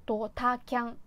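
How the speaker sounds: ドタキャン drawn out, beat by beat; slow pronunciation. Shown as a demonstration. Showing it is manageable slowly, ドタキャン carefully drawn out. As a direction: say ドタキャン slowly, stretching each part. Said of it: "これゆっくりだと喋れるんですよ".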